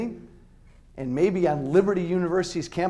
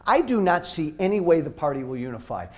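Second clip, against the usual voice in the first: normal talking voice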